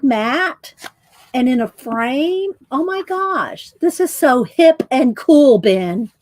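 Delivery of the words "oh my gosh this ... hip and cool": excited. Real, not acted. Gushing over the art.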